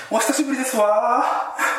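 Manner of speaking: put-on high rich-girl lilt on desu wa; sing-song haughty heiress greeting; ojousama voice. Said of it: "お嬢様言葉だと".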